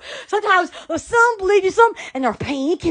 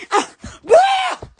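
high excited whoop; 13 semitones higher, 3 dB louder than usual. Real, not acted. Vlogger cheering to her viewers, high whoop. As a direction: let out a happy woo.

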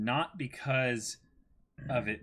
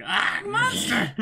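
hammed-up scream, much higher and slower; mock frightened cry; 8 dB louder, slower than usual. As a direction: squeal it like a cartoon victim. Reading out a character's cry.

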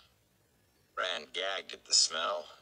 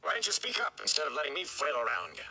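put-on lighter, quicker young man's voice; a frustrated farm lad demanding answers; higher and faster, pushing back hard; young Rand, exasperated; 7 semitones higher, faster than usual.

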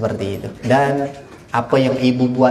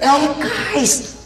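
calling out; 12 semitones higher than usual. Retold or imitated. Laila calls Kais in story.

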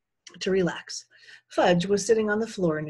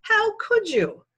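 high, loud, drawn-out accusation; outraged; 7 dB louder, much slower than usual. A narrator's reading. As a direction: stretch it out in outraged disbelief. Accusing Sheila over the cover.

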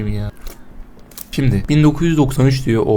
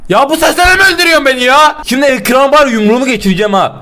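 high, loud furious complaint; high loud angry rant; frustrated yell; 13 semitones higher, 9 dB louder than usual. Real, not acted. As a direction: rage at the game. Angry at game killing him.

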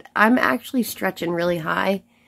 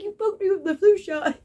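high mock indignant protest; retold protest; 10 semitones higher than usual. To the patient who poked her arm, high mock protest. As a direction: squeak it out like you were just poked. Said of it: "I was like".

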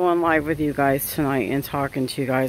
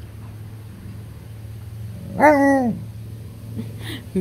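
breathy laugh; 9 semitones higher than usual. Real, not acted. Laughs after the dog voice.

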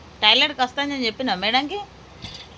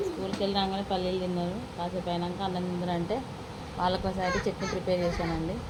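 softer, lower narration for the viewers; vlog voiceover; 4 semitones lower, 10 dB quieter than usual. Acted. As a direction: talk the viewers through the chutney, softly.

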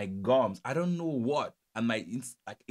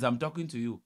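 ordinary talk

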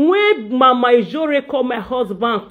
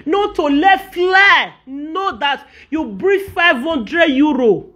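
raised, exasperated telling-off; heated rant; 5 semitones higher, slower than usual. Real, not acted. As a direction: call her out, worked up and incredulous. Ranting at Mommy Jore.

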